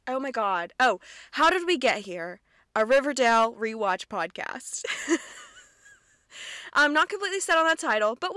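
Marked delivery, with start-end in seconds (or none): none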